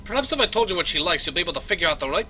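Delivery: conversational Scottish brogue; Scottish accent. Acted. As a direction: offer help as a Scottish engineer. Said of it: "my Scottish accent needs some work".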